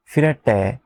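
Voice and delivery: ordinary talk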